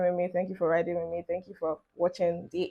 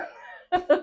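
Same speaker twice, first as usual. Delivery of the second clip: quick squeal of a laugh, much higher; high burst of laughter; 11 semitones higher than usual. Real, not acted. Vlogger laughing at viewers who skip subscribing. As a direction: laugh out at her own joke.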